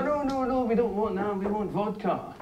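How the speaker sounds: high, whiny mock refusal; dismissive young bartenders. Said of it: "Except not in a Scottish accent".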